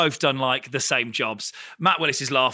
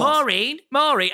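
calling Maureen; 9 semitones higher, 3 dB louder, slower than usual. Acted. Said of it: "you could be like".

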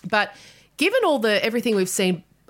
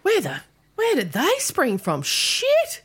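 high, startled disbelief; genuine shock; 10 semitones higher, slower than usual. Real, not acted. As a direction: gasp out the number in shock. Shocked at four children.

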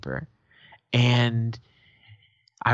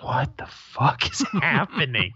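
slow, higher, stunned disbelief; bewildered; 4 semitones higher, slower than usual. Retold or imitated. Girlfriend standing there watching the family fight, bewildered. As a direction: imitate a baffled onlooker, stretching the words through a laugh.

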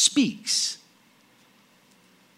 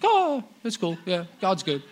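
lightly mocking, higher, offhand voice; mock indifferent churchgoer; 5 semitones higher than usual. Preacher mocks expressionless worshippers, offhand and higher. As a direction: play a bored churchgoer shrugging it off. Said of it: "Just no expression".

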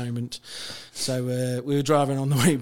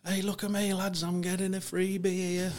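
retold shout; 7 semitones higher than usual. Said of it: "Daz is shouting".

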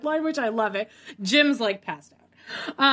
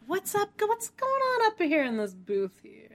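high, dopey imitation of Jim; high mock Jim voice; imitating Jim; 8 semitones higher, 5 dB quieter than usual. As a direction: play him clueless and high. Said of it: "he's like".

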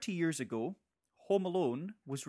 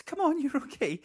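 coaxing; 12 semitones higher, 5 dB louder than usual. Pretend. Coaxing a frightened person out.

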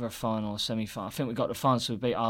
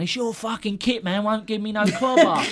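high, indignant mock moan; a hammed-up grumbling footballer; 8 semitones higher, 4 dB louder than usual. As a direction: whine it like the aggrieved teammate. Quoting Johnny Monk's complaint.

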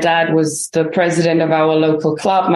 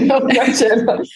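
higher, amused echo through a laugh; 6 semitones higher than usual. Real, not acted. Guest to host before a guessing game, laughing. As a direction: laugh while echoing it back.

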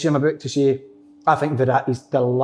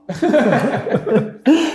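laugh; 9 semitones higher than usual. Real, not acted. Laughing at his stalling.

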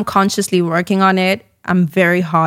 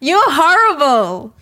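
mock outrage; 7 semitones higher, 3 dB louder, slower than usual. Real, not acted. Guest protesting the host's impression.

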